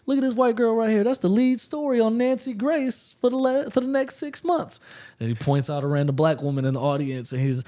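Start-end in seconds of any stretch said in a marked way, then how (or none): 0.1-4.8 high, loud teasing crowd-work call-out; mimicking Patrice O'Neal. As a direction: point at her and rib the whole room. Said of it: "he's like".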